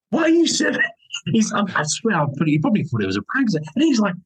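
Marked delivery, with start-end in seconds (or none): 0.1-0.8 imitated baffled fan, pitched high; mock disbelief at who is serving; astonished. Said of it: "he was astonished".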